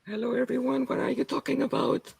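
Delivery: soft speech on the inhale; speaking while breathing in. Shown as a demonstration. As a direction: read it out while sucking air in. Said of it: "as I breathe in".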